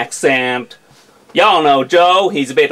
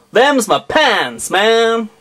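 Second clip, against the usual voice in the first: American accent; 4 semitones higher, slower than usual. Shown as a demonstration. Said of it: "an entire video in an American accent".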